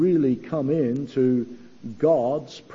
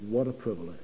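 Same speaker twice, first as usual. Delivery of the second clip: softer and lower, a hushed echo of her words; very quiet; 5 semitones lower, 8 dB quieter, much faster than usual. Retold or imitated. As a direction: recall it quietly, with reverence. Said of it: "Helen said".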